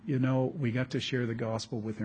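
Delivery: ordinary talk, nothing marked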